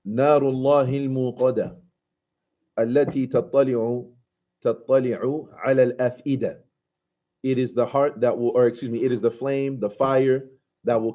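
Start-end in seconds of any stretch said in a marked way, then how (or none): 0.1-6.6 fast melodic Arabic recitation; تلاوة مرتلة; Quran recitation. Acted. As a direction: chant the verse in tajwid style.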